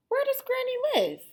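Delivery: very high put-on sweet voice; sugar-coat it way up high; sweet-talking wolf. Hungry wolf tricking Little Red, very high sweet voice.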